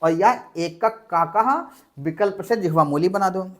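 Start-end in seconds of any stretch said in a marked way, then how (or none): 0.1-1.5 slow: एकक काकः with a doubled k replacing the visarga; teacher demo of एकक काकः; half k pronunciation. Shown as a demonstration. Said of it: "दो विकल्प बन सकते हैं".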